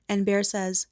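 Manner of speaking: ordinary delivery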